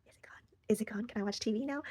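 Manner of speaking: hushed, cautious little questions; tentative whisper. Retold or imitated. Woman checking whether the tears have passed, tentative whisper. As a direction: peek out and ask it under your breath.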